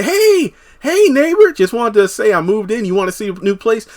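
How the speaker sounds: high sing-song flirty woman; high, sweet neighborly flirt. Retold or imitated. Retelling flirty neighbor Deja.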